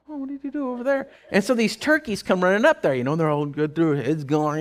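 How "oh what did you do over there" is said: high, quick deer voice; a puzzled animal piping up high and fast. Retold or imitated. Deer questioning the man feeding them, puzzled and high. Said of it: "even the deer were like".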